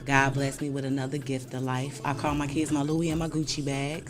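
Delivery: usual speaking voice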